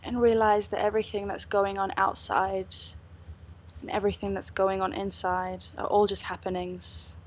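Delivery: calm, unhurried meditation guide; guided meditation. Acted. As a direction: lead them gently into stillness.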